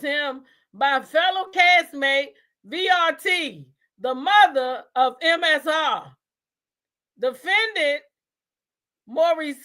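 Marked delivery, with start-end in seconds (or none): none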